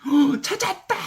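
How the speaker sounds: very high-pitched, gasping cry of discovery; acted burst of excited relief; mock jubilant outcry. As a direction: exclaim it like overjoyed children, hammed up. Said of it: "그러면서 막 난리법석인 거예요".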